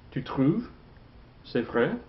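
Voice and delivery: "trouves" and "vrai" lifted as questions; rising intonation. Shown as a demonstration. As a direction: ask both, pitch climbing on "trouves" and "vrai". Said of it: "make the intonation rise".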